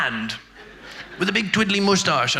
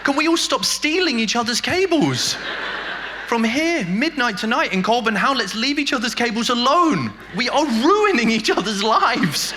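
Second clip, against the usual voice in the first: mock rallying speech; 7 semitones higher than usual. Mock public appeal.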